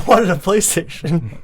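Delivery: a spoiled daughter whines to her father about her gift; whiny teen. Retold or imitated. Acting bratty kid whining.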